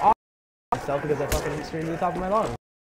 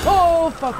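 startled shout; 10 semitones higher, 7 dB louder than usual. Real, not acted. Jump scare in horror game.

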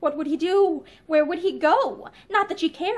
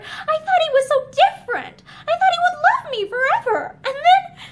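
much higher and louder, near breaking; rising anguish; 11 semitones higher, 5 dB louder than usual. A narrator's reading. Linda confessing her betrayal, anguished high cry. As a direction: let the pain climb to a cry.

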